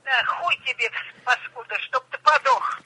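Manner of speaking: furious curse down the line; angry over the phone. Real, not acted. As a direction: spit the curse out, rising to a high, slow shriek. Old woman cursing the caller.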